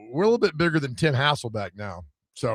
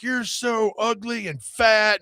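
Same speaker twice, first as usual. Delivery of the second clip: higher, slow mock taunt; heckling fan; 8 semitones higher, slower than usual. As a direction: drag out the heckler's insult. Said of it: "just went off on me".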